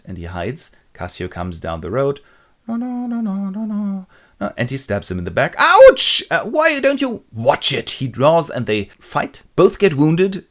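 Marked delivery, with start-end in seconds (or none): 2.7-4.5 high, singsong no-no-no; carefree passer-by. Acted. Cassio strolls down the road unaware, singsong. 5.6-7.9 very high, loud comic ouch; yelp, then complain indignantly; yelp of pain. Acted. Man stabbed in the back, mock pained yelp.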